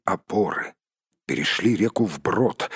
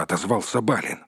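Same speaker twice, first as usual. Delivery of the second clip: ordinary delivery, nothing marked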